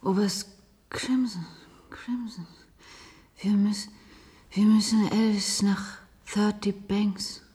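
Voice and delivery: whispering. Acted. Drugged Jane whispering to Robert.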